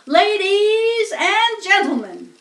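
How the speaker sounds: high, loud, slow announcer call over the fair; loudspeaker announcer. A narrator's reading. Fair loudspeaker addressing the crowd, booming announcer. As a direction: boom it out like a fairground loudspeaker. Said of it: "continued the loudspeaker".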